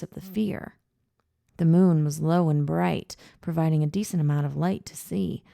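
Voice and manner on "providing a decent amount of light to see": usual speaking voice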